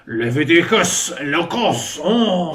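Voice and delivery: loud, slow mock French wizard casting spells. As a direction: cast fake spells like a hammy French wizard. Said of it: "a French wizard who's like".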